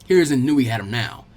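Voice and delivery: plain reading voice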